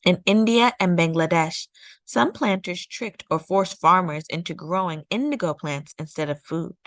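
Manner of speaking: usual speaking voice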